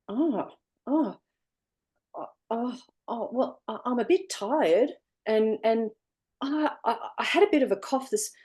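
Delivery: high, stammering, caught-off-guard voice; flustered student. Retold or imitated. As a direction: falter and hedge as if unsure. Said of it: "And the student went".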